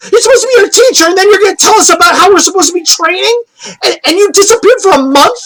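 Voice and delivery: high-pitched indignant tirade; upset scolding. Acted. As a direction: throw accusing questions as a hurt girl. Acted girl scolding her absent teacher.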